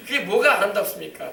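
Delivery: ordinary delivery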